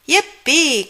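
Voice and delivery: high, loud cheer; excited cry. Acted. Froggy cheers about the contest, excited. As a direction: whoop with joy. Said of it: "cried Froggy".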